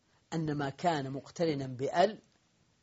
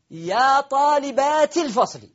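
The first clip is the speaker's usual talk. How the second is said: calling out; 8 semitones higher, 7 dB louder, slower than usual. Shown as a demonstration. Teacher calls out vocative example.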